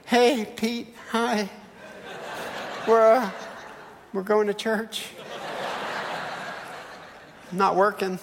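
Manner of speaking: sudden drop from yelling to awkward politeness; halting, embarrassed small talk with long pauses; sheepish. Retold or imitated. Dad caught yelling by next door neighbor, sheepish.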